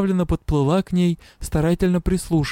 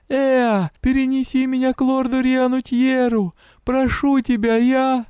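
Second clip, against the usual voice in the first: high pleading cries; desperate pleading; 8 semitones higher than usual. A narrator's reading. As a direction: beg for rescue in panic. Begging the god to save her.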